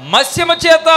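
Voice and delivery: high, loud punchline; shouted answer. Real, not acted. Preacher answering his own question, shouted high. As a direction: hammer the answer home.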